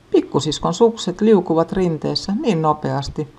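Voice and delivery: ordinary delivery, nothing marked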